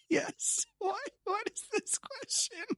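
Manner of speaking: high, slow, amazed disbelief; baffled. Real, not acted. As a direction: squeak it out in disbelief. Guest baffled by zoo question.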